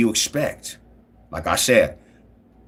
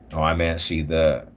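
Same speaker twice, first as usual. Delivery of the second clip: ordinary talk, nothing marked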